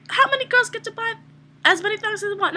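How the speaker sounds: mock-thrilled thought; excited. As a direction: pitch it up with giddy glee. Hyped-up inner justification.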